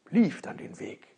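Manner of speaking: ordinary delivery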